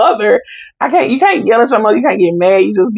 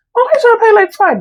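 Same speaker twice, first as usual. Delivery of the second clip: much higher, puzzled cry of why; baffled question. Retold or imitated. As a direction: ask it as a shocked, confused teen. Confused teen at restaurant.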